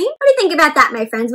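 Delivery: plain reading voice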